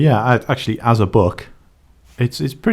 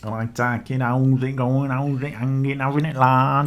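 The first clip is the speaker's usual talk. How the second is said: slightly raised, drawn-along put-on voice; mock rock-star voice; 4 semitones higher than usual. Co-host mimics nineties rock star, in a mock swaggering voice. Impression straight after [name] talk.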